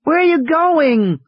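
put-on high, slow alarmed child. A narrator's reading. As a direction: call after him in sudden alarm. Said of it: "suddenly alarmed".

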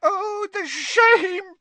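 crying little elf, lamenting; tearful house-elf. A narrator's reading. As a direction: elf mourning her lost master.

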